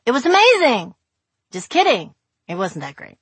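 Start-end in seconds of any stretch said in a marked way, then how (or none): 0.0-0.9 mock excitement. Mock enthusiasm then "just kidding.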